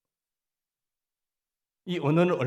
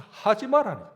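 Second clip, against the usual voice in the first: Gyeongsang front accent; 8 semitones higher, 8 dB louder than usual. Shown as a demonstration. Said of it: "앞에서".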